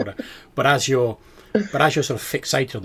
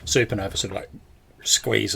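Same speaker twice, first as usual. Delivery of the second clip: normal talking voice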